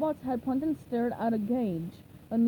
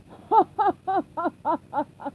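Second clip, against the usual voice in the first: long, loud, high villain cackle; evil laugh; 6 semitones higher, 7 dB louder than usual. A narrator's reading. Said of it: "a long, loud, evil laugh".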